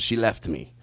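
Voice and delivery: low, soft imitated confession; imitated acquaintance's voice. Man tells his regular about his wife, low imitated voice. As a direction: drop pitch and volume as him. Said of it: "do him doing that voice".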